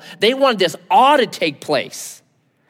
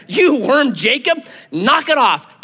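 high, drawn-out mock scolding; hammed-up, emphatic rebuke; God agreeing bluntly; 6 semitones higher, 3 dB louder, slower than usual. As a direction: tell him off in raised pitch. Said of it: "like he agrees with him".

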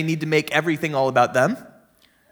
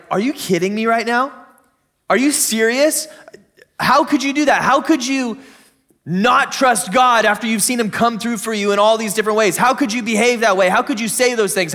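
raised-pitch, mock-indignant questions; incredulous judgment; 4 semitones higher than usual. Reader judging people in the Old Testament story, incredulous scolding. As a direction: pile up disbelieving questions, pitched higher. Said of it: "you look at the people in the story and you're like".

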